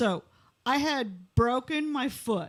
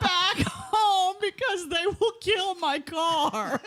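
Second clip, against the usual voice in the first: laughing; 6 semitones higher, slower than usual. Retold or imitated. Retold plea, laughing.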